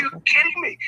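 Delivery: imitating an excited, high-pitched reaction; high excited mock exclamation; really excited. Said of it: "He was, like, really excited".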